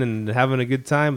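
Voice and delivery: normal talking voice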